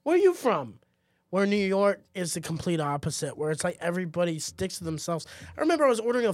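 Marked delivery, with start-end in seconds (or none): 0.0-0.8 high, loud friendly Austin greeting; chirpy small-town hello; mock friendly stranger; friendly local greeting. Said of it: "They'll be like".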